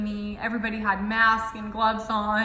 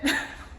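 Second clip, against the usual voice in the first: nervous laugh; 10 semitones higher than usual. Real, not acted. Nervous laugh recalling fear.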